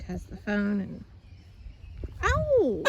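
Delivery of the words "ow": high yelp of pain; sharp ow. Real, not acted. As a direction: yelp when pecked. Pecked by chicken.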